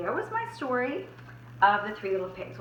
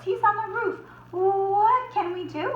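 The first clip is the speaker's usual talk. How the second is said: frightened pig; 10 semitones higher, 3 dB louder than usual. Acted. Frightened pigs.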